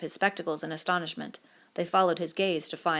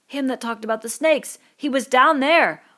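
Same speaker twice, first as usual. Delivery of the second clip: put-on frightened boy, louder and higher; searching the crowd in growing fear; Piggy alarmed; 7 semitones higher, 9 dB louder than usual. Piggy asking where the marked boy is, alarmed.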